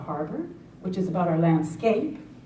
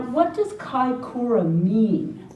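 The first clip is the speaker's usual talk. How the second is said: ordinary talk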